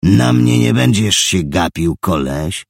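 loud, high snarl; growled threat. Acted. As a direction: growl it like a bully. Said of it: "warknął facet".

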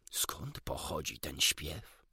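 hushed, slow, low wonder; surprised question. A narrator's reading. Doctor asking where the song comes from, quietly surprised. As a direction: ask it slowly and softly, taken aback. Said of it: "zapytał doktor zaskoczony".

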